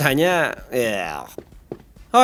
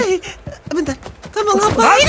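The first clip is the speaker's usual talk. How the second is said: alarmed shout. Acted. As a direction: yell out in alarm. Character alarmed at a grab.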